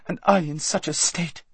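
put-on pampered girl sulking; hurt Lazareline. A narrator's reading. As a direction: reproach her friend. Lazareline self-pitying.

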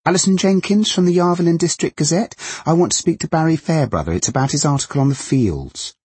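high, fast, chirpy reporter voice; bright-voiced young reporter chattering on; jaunty loud journalist. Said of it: "jaunty voice was as loud and horrible".

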